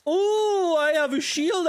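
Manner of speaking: sudden high, loud surprise; oh, a shield, caught off guard; surprised. Real, not acted. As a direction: blurt it out as you notice. Discovers a shield mid-fight.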